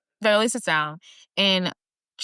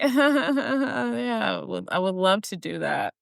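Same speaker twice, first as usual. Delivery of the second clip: mock a mother pretending to be pleased; forced agreement; 3 semitones higher, slower than usual. Mother accepting the unwanted honor, forced agreement. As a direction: fake laugh into a strained yes.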